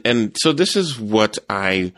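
normal talking voice

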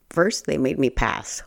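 ordinary talk

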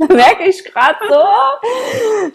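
giggling, can't stop chatting; laughing. Real, not acted. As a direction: laugh it out while wrapping up. Laughing at chatting too much.

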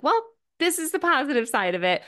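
high, fast mock-chipper spin; mock forced cheer. Past self spinning sadness into cheer, mock chipper. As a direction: chirp it like covering a bad feeling. Retelling her old forced positivity.

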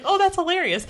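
squeaky high delighted quote; mock-delighted squeal; amused. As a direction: pitch way up, tickled. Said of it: "I was like".

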